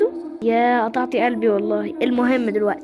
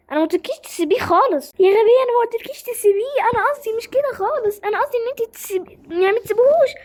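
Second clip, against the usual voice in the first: shocked scolding; 7 semitones higher than usual. Acted. Friend shocked and scolding her.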